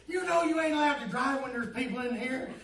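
loud, high imitation of an alarmed sergeant; yelled protest. Sergeant objecting to the recruit driving, loud high-pitched protest. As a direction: yell it from the back of the truck.

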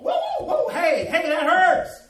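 loud, high cries of protest; pained protest. Acted. Clay lump begging the potter to stop, loud pained protest. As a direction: yelp out in alarm, high and loud.

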